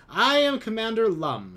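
proud commander. A narrator's reading. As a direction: puff up and announce your title. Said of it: "he says proudly".

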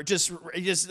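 usual speaking voice

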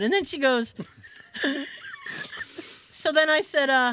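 ordinary talk, nothing marked

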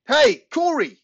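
high, loud call across the crowd. A narrator's reading. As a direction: call out to find him. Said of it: "I call".

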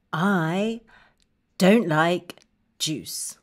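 low and downcast, word by word; halting, subdued refusal; glum dislike. Shown as a demonstration. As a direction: say it low and disappointed. Modelling dislike of juice.

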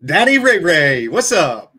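loud name shout-out; calling out. Real, not acted. Host welcoming the guest from down under, loud shout-out. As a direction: hail the guest as he arrives.

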